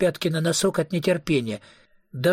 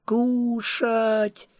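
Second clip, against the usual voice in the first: high, drawn-out mock wail; whining; 8 semitones higher than usual. Tommy whining to Jody for food, childishly plaintive whine. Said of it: "Нытики никому не нравятся".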